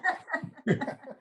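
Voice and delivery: high-pitched giggle; high laugh. Real, not acted. Host laughs at himself.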